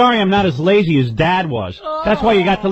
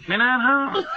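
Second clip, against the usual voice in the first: 1 mock mother; 8 semitones higher, 4 dB quieter, slower than usual. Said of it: "She's just talking to my phone".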